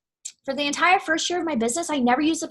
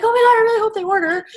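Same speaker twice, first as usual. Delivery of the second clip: high, fast, louder nervous plea; anxious hoping; 8 semitones higher, 6 dB louder, faster than usual. Retold or imitated. Seller showing the desperate mindset to avoid, anxious high plea. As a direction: sound desperate for the sale. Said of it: "Instead of being like".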